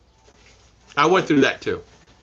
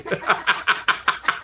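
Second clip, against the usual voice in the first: breathy laugh; 5 semitones higher than usual. Real, not acted. Laughing at retold story.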